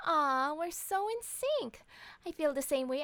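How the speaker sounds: sarcastic aww; mock sweet. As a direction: coo it with fake affection. Sarcastic reply to husband's insult.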